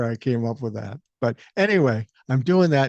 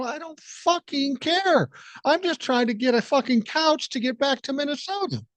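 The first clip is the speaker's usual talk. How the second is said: retold exasperation; 12 semitones higher than usual. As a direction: whine it high, stretching the swear, fed up. Retold argument with pushy customer.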